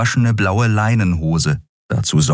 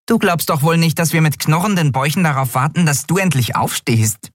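much higher, quick teasing voice; a tiny winged creature teasing her friend; cheeky little Nelen; 10 semitones higher than usual. Acted. Nelen teasing the captain for sleeping late, high and quick.

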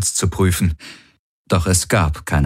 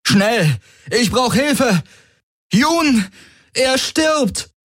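much higher, urgent call down the corridor; calling in Rokaitor's voice; much slower than usual. Acted. Valur calling into the corridor as Rokaitor, faked alarm. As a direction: fake panic as Rokaitor. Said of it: "rief er mit Rokaitors Stimme".

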